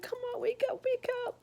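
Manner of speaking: high, urgent call to wake them. Retold or imitated. Calling sleeping brothers awake in panic, high and urgent. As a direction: shake the brothers awake with your voice. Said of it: "trying to make my brothers wake up".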